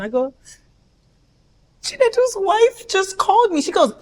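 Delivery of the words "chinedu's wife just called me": high-pitched wail to mom; distraught daughter. Retold or imitated. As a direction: report it as a mock-tearful whine. Tells mom the shocking news.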